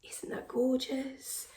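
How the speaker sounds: usual speaking voice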